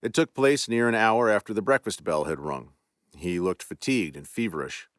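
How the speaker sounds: plain reading voice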